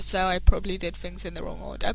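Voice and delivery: usual speaking voice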